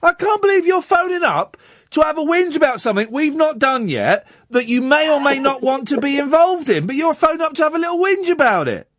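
high-pitched, indignant telling-off; worked-up exasperation; exasperated rant. Real, not acted. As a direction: berate the caller in disbelief, pitch well up. Host berates caller for whingeing.

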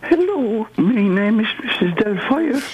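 very high falsetto lady; ropey high-pitched character impression; Mrs Doubtfire. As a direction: put on a squeaky film-nanny greeting. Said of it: "Can you do it again".

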